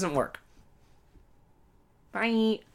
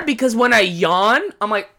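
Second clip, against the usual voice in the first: usual speaking voice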